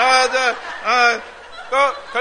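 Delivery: bursting into loud laughter; laughing loud. Real, not acted.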